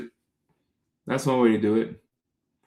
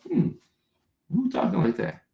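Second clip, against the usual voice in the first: puzzled; 8 semitones higher, 3 dB quieter than usual. Retold or imitated. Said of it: "we're like".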